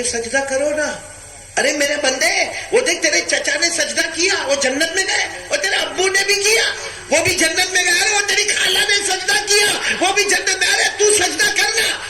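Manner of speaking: high, climbing exhortation; impassioned preaching. Acted. God urging a man to prostrate like his relatives, rising impassioned preaching. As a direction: urge harder with each phrase, pitch rising.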